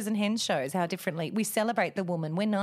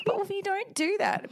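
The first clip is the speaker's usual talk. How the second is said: mum telling her son off, pitched way up; high, half-joking reprimand; mock scolding; 13 semitones higher than usual. Mum scolding Wolfie in a high mock voice.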